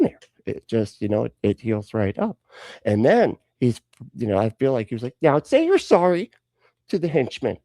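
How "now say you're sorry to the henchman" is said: high, loud stern order; mock exasperated parent; scolding parent. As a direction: order a child to apologise, voice raised. Jesus scolds Peter like parent.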